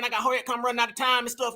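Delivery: fast, hurried mock of her excuse; rushed mock quote. Host mocking the woman's excuse, fast and hurried. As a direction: rattle it off fast like she's in a hurry. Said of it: "Now it's like".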